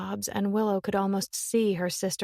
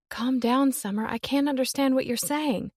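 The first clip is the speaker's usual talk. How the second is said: urgent, raised pleading; anxious sister on the phone; 6 semitones higher than usual. Acted. Willow begging her sister to calm down, urgent. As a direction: try to calm a panicking sister.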